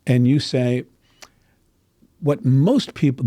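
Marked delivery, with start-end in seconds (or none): none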